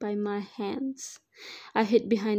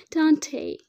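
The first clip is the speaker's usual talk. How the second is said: ordinary delivery, nothing marked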